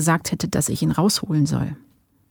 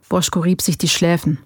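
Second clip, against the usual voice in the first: ordinary delivery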